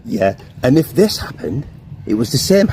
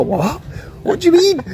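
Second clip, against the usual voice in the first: "What?" rising into a much higher "What do you mean?"; friends' disbelief. Retold or imitated. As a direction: play the shocked friends. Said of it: "And they used to go".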